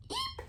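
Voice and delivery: tiny, very high squeak; a little mouse squeaks, very high. Acted. A mouse returns.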